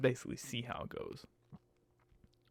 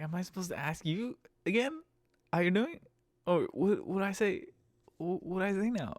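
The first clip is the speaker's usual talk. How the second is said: mock confusion; 7 semitones higher than usual. Driver mocking how to answer passenger, sarcastic higher. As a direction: sarcastically play dumb.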